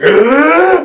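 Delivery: sharp, loud, startled gasp for air; waking gasp. Retold or imitated. Waking from an actor's nightmare: loud startled gasp. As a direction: jolt awake with a gasp. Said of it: "like, gasping".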